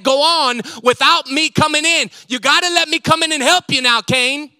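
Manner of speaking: loud, high, urgent pleading as God; God pleading with Cain. Acted. God warning Cain before sin takes hold, loud and urgent. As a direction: step in like a loving father warning his son. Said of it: "And God notices".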